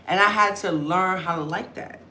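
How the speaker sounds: normal talking voice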